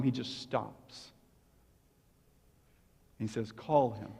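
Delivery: low, soft and slow; hushed reverence. Acted. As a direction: let the stillness speak. Dramatic hushed moment.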